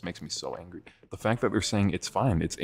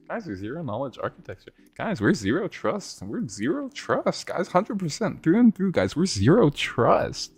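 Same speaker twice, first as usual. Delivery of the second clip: mocking corporate PR; 8 semitones higher than usual. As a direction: parody a smug company voice. Mocking company PR line.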